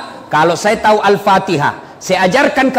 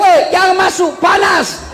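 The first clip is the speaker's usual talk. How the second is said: mock shout; 10 semitones higher than usual. Chinese man at the lift warns the Haji, hammed-up shout. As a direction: yell a frantic warning at the door. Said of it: "dia teriak".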